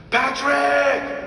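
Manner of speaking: yelling Patrick across the stairwell, high and loud; retold scream. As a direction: call out the name like a furious dad downstairs. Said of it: "you hear my dad screaming".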